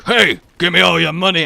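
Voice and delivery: loud mugger demand; hammed-up stick-up line; mock mugger. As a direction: bark it like a cartoon mugger. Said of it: "but going".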